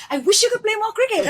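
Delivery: high, loud, laughing gush; giddy laughing fan gush; starstruck gush. Retold or imitated. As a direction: blurt it out like an awestruck fan, half laughing. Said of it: "Why the hell am I saying this?".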